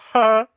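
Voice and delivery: loud, high imitated "huh"; famous catchphrase laugh. Retelling the actor's famous sound, a loud imitation. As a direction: burst out an imitation of the trademark laugh. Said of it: "he did the famous".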